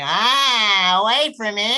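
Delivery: high, loud wailing whine; whining siren. Retold or imitated. Wife whining behind him like a siren, loud high wail. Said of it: "She's behind me whining the entire time".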